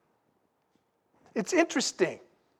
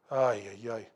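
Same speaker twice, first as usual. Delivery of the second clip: low groaning ay ay ay; 12 semitones lower, 8 dB quieter than usual. Real, not acted. Groaning at the whiner.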